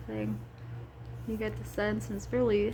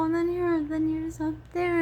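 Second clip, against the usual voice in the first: ordinary talk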